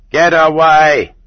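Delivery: guard's loud, drawn-out bellow; shouted order to clear off. A narrator's reading. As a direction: bellow it high and slow. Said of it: "bellowed the guard".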